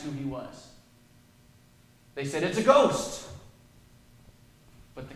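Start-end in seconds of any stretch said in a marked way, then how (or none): 2.4-3.3 mock fright. Preacher replays disciples' cry.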